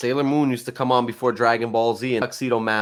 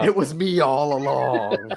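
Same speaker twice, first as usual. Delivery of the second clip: slow, drawn-out villain reveal with a laugh; 2 semitones higher, slower than usual. Acted. Taunting co-host as the secret mastermind, slow villain voice. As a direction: stretch it into a mock wrestling reveal. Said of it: "I'm the higher power".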